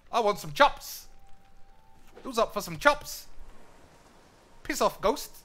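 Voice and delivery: high, loud attempt at a New Zealand accent. Shown as a demonstration. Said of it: "The New Zealand one".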